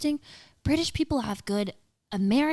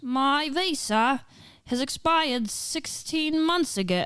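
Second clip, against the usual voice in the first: slow, higher attempt at an Aussie accent; carefully faked Australian accent; 6 semitones higher, slower than usual. Shown as a demonstration. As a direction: drawl it out in put-on Australian. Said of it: "how does an Australian accent sound".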